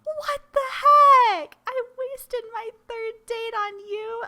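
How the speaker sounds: high, slow indignant yell; outraged shout. Real, not acted. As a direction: shout at the screen in real disbelief. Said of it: "I'm so mad".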